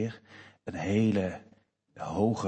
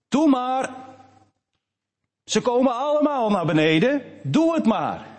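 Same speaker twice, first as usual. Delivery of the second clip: high, louder calling up to her little brother; calling up encouragingly; 14 semitones higher, 5 dB louder than usual. Retold or imitated. Older sister calling up from below to her brother, high encouraging call. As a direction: shout up the slide to coax him down. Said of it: "die riep".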